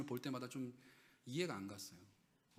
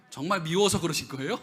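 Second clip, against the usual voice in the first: ordinary talk, nothing marked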